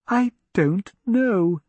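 barely holding fear together; fearful. Acted. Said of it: "trying desperately to control her own fear".

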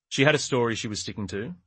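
ordinary delivery, nothing marked

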